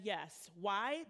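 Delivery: ordinary delivery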